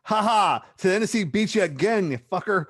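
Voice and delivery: high, loud mock crowing; gloating taunt. Podcaster taunting Jim Cornette over Tennessee, high loud gloat. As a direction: crow it loudly at an absent rival.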